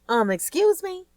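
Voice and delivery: high, sharp disbelief; as if you can't believe your ears; incredulous. A narrator's reading. Kelly hearing her ex on the phone, incredulous. Shocked at caller.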